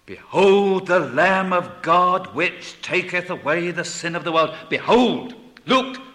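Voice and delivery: loud, high, ringing proclamation; full-force preaching. Real, not acted. Preacher urging hearers to behold the Lamb, proclaiming loudly.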